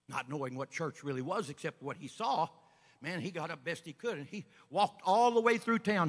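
usual speaking voice

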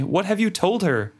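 high-pitched, dismayed demand; dismayed cry. A narrator's reading. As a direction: read it as an alarmed question, not a shout. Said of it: "I cried in dismay".

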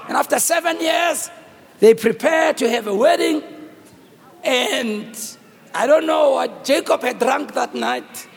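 ordinary talk

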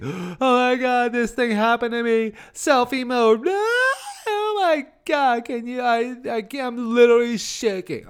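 high, loud, hammed-up sobbing; mock crying. Comic mocking people who film themselves crying, mock crying. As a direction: imitate a selfie-video meltdown, wailing words. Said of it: "somebody filming themselves crying".

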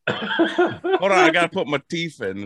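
put-on old person voice. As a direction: play a creaky old man who needs his teeth in. Imagining themselves old on the podcast.

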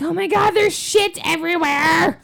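high, loud shocked outburst; panicked yell. Retold or imitated. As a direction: blurt it out in horror. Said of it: "And I was like".

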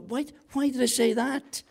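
high-pitched mock dismay at himself; hammed-up, high inner wail; dismayed self-questioning. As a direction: replay the regret, pitched right up. Acting out his regretful thought.

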